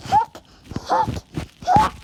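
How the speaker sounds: three quick hiccups in a row. Acted. Character has hiccups.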